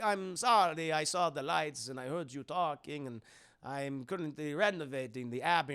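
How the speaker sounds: hammed-up vampire accent; Dracula. Dracula enters through the backyard, mock vampire voice. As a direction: play the polite foreign count. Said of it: "And he's like".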